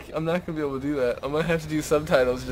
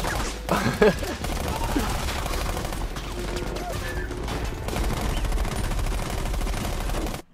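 long helpless laugh. Real, not acted.

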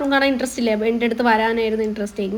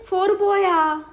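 Four പോയാ with പോയാ pitched much higher and louder; playful high question; 6 semitones higher, 4 dB louder, much slower than usual. Real, not acted. Mother playfully asks the toddler about the missing four. Counting toys with baby.

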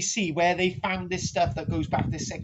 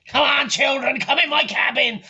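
put-on higher, loud call to children; luring cabin man; 4 semitones higher, 4 dB louder than usual. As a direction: call them over like a dodgy salesman. Acts the fish finger man luring children.

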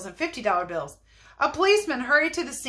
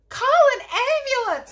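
very high, loud shriek for help; screaming; 6 dB louder, faster than usual. A narrator's reading. Lady screams for help amid the chattering crowd. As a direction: scream it urgently at full pitch. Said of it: "screamed the lady".

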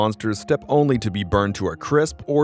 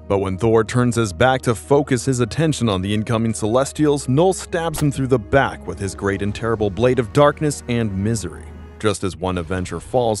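usual speaking voice

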